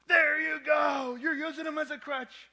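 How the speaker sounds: high, loud shouted jeer, slow and mocking; mocking heckler. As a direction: throw it back like a scornful stranger on the street. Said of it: "He goes".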